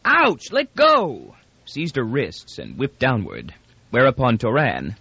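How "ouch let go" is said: much higher pained yelp; high yelping ouch; yowl of pain. A narrator's reading. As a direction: cry out as your hair is pulled. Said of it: "He yowled".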